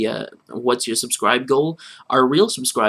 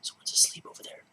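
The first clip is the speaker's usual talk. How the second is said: hushed, voiceless warning; whispered aside; 12 dB quieter, faster than usual. Real, not acted. Warning co-host someone's asleep over there, whispered. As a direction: whisper it very softly.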